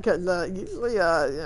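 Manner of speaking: ordinary delivery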